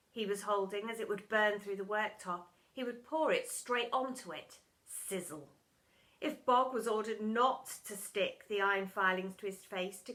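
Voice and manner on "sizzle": usual speaking voice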